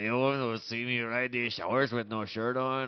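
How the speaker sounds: put-on absurd Mexican-accented leader, building to a loud boast; Vladimir Putin. Comic playing the Russian leader bragging, heavy Mexican accent. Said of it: "speaks with a heavy Mexican accent".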